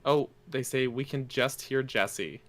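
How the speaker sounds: ordinary delivery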